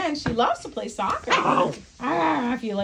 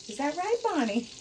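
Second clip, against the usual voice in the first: higher voice for the dog; asking Bonnie in a higher pet voice; dog talk; 6 semitones higher than usual. Real, not acted. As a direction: ask the dog sweetly, a bit higher. Talking to the dog.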